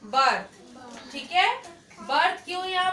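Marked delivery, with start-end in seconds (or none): 0.1-1.1 slow, drawn out. Shown as a demonstration. Teacher modelling birth slowly for the class. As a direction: stretch birth out very slowly and a bit lower. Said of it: "यहाँ पे आप जो word देख रहे हो वो क्या है?".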